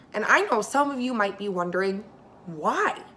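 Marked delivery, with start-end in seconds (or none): none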